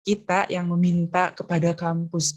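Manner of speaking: usual speaking voice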